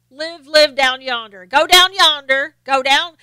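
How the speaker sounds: mock Southern drawl. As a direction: stretch it out like a Southern local. Drawling Southern talk, yonder.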